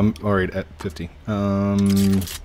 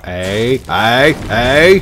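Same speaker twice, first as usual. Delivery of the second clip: rising, louder shouts of hey; calling out; 6 dB louder, much slower than usual. Real, not acted. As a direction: call out to get their attention. Calling teammates' attention.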